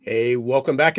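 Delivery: ordinary talk, nothing marked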